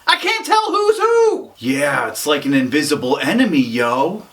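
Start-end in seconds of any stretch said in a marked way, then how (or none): 0.1-1.5 very high-pitched underling reporting to the boss; excitable underling. Acted.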